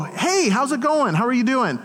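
high, cheery phone hello; upbeat retold greeting; friendly greeting.

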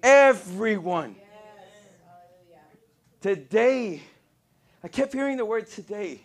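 slow, stretched words with heavy punches; emphatic preaching. Real, not acted. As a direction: proclaim it to the congregation, word by word. Preaches at full force.

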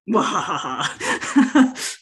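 slow, drawn-out evil mwahaha; a gleeful villain cackle, stretched out; mad scientist laugh. Pretend.

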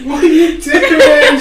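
squeaky scolding through laughs; high voice breaking into laughter. Acted. The elf scolds her mate while the GM laughs. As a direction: keep the elf voice but crack up.

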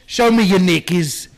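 loud, slow hammed-up Cockney; British accent. Friend answers with a loud hammed-up Cockney line. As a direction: ham up a London lout. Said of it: "English street garbage".

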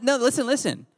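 ordinary talk

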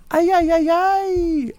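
high, squeaky robot panic; Alpha Five voice. Retold or imitated. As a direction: yelp it like a flustered robot. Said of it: "Alpha Five".

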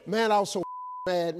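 usual speaking voice

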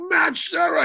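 excited fan greeting. Retold or imitated. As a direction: greet a celebrity you just spotted. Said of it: "And he's like".

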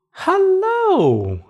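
high, bright little bunny greeting. Acted. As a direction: call out like a small curious bunny.

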